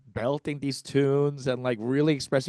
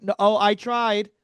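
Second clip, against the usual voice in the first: high, flustered cover-up voice, much higher; nervous Eric; 9 semitones higher than usual. Retold or imitated. Friend at the bathroom door nervously covering for her, high and flustered. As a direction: play the anxious friend stalling at the door. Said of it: "Eric's like".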